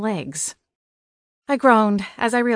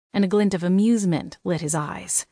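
ordinary delivery, nothing marked

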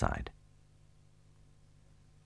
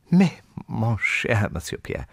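high, drawn-out French address, lightly voiced; remonstrating hostess; 6 semitones higher, slower than usual. A narrator's reading. Anna Pavlovna rallies against Pierre, lightly remonstrating. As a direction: protest slowly and politely, pitched up.

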